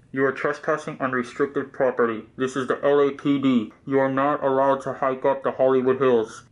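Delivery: loud official announcement through a speaker; imitated LAPD loudspeaker warning; police loudspeaker. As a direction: boom it out like a police PA.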